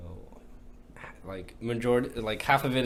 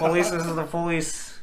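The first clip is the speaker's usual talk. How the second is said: mock police; 6 semitones higher, 5 dB louder than usual. Mock police bust joke.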